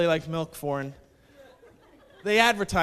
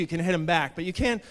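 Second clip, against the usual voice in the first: usual speaking voice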